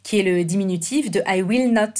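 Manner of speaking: ordinary talk, nothing marked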